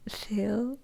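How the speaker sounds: usual speaking voice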